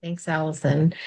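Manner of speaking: normal talking voice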